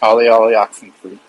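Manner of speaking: stiff robot voice testing the line. Pretend. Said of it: "I'm doing a robot voice".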